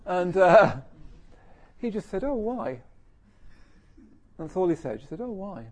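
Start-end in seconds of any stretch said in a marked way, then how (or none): 2.2-2.8 high-pitched, unruffled "Oh, why?"; lightly surprised, no offence taken; mild, simple puzzlement. Retold or imitated. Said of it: "just a bit like that". 5.2-5.7 high, gentle surprised question; lightly puzzled, without any reproach; mild, simple puzzlement. Retold or imitated. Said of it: "just a bit like that".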